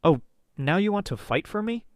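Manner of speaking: high scornful retort; shot back. A narrator's reading. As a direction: throw it back at him. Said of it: "I shot back".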